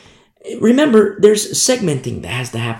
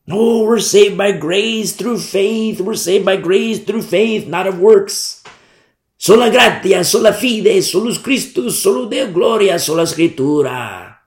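mocking quote; 5 semitones higher than usual. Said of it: "That's what they say".

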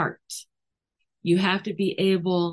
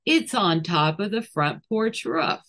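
ordinary talk

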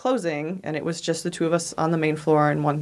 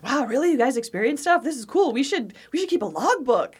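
higher-pitched mock amazement; excited, intrigued; 6 semitones higher than usual. Store owners reacting to staff's ghost stories, excited and intrigued. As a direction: imitate delighted curiosity, pitch lifted.

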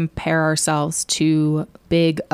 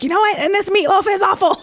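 put-on much higher, laughing bratty kid voice; defiant kid; 14 semitones higher than usual. Girl mocking her parents' meatloaf, high bratty laughing voice. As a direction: sass the meatloaf like a laughing brat. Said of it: "add insult to injury of like".

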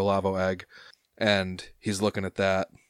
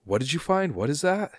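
higher, probing question; suspicious harbor master; 7 semitones higher than usual. Acted. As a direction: press the worker about what he found.

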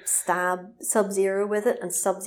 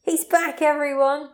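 high, hyped proclamation; high crowd-rousing call; excited announcement; 7 semitones higher than usual. Acted. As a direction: proclaim the hero's return to the crowd. Hyped announcing of the hero's return.

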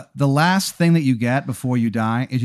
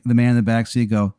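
normal talking voice

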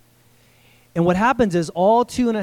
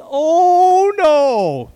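much higher, loud anguished Oh, no; despairing oh no; 10 semitones higher, 9 dB louder than usual. Shown as a demonstration. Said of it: "my loose translation of woe".

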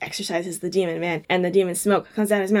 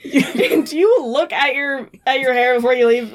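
laughing; 3 semitones higher, 5 dB louder, slower than usual. Retold or imitated. Teasing Cass about hair.

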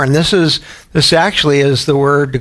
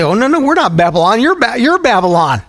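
mock indignant denial; 8 semitones higher than usual. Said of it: "we might look at this and say".

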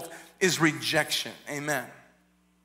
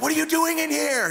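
high, loud outraged question; angry demand; 10 semitones higher, 3 dB louder than usual. Retold or imitated. Jacob confronting Leah in the tent, loud and high. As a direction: demand it in hot disbelief. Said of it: "he's angry".

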